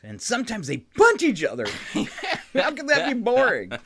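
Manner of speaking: high, lively reading out; excited tweet reading. Acted. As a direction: read it with rising excitement. Reads tweet with building excitement.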